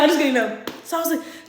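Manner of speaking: ordinary talk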